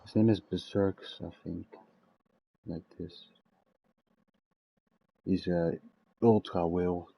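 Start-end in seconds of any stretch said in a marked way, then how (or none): none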